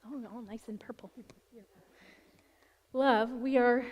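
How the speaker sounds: ordinary delivery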